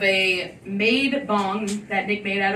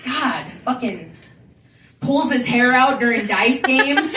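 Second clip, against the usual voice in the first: usual speaking voice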